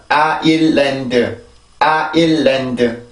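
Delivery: 아일랜드 slow, in four even syllables: a-il-laen-deu; Korean-style four syllables. Shown as a demonstration. Teacher showing Korean reading, 아일랜드 as four slow syllables. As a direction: stretch 아일랜드 evenly with a full final 드. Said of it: "우리나라로 발음으로 읽을 때".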